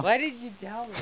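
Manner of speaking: high exasperated complaint. Real, not acted. Upset at being filmed.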